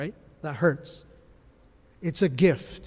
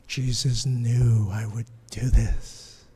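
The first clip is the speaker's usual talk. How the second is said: lower, slow whisper of realization; 6 semitones lower, 3 dB louder, slower than usual. Acted. Voicing Peter's inner realization.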